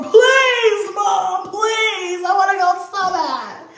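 put-on high, loud pleading cry of a child on the floor; desperate begging. Daughter on the floor begging mom for New York, loud pleading. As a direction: beg like a kid on the floor, all out. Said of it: "She's like on the floor".

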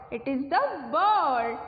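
ordinary talk, nothing marked

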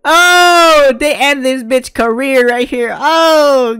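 very high, loud screams of shock; excited shriek. Real, not acted. Streamer screaming as the character gets knocked out, shocked. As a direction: scream at the brutal hit. Reacting to a violent hit.

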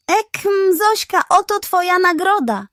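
high, hurried offer after a throat clear; quick pug voice. Acted. Pug hastily hands the hen her reward, high and loud. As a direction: rush the words out, caught in the act. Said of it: "powiedział szybko".

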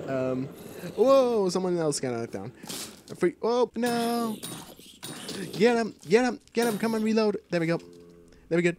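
normal talking voice

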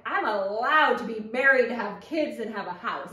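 triumphant declaration. Retold or imitated. Woman recalls turning thirty and finally being allowed, triumphant declaration. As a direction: declare it proudly, stretching the first words.

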